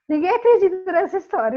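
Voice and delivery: rindo enquanto fala; a laugh breaking into the words. Real, not acted. Rindo ao contar história incrível. (falando entre risos, achando graça)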